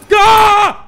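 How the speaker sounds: high, loud shout of panic; panicked yell. Real, not acted. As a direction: scream to make it go. Escaping the monster.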